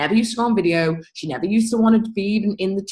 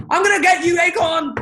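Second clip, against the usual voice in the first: high, loud hammed-up threat; mock villain shout; 9 semitones higher, 5 dB louder than usual. Smashing the acorn, a loud hammed-up villain. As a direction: yell it like a cartoon bully chasing the acorn.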